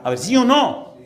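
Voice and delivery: loud, high preaching demand; forceful challenge. Real, not acted. Preacher demanding the congregation answer, loud and insistent. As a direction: press the crowd for an answer at full voice.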